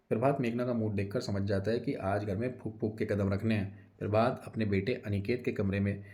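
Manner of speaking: ordinary delivery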